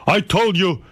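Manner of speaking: mock stern dad laying down the law; imitating father. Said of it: "He goes".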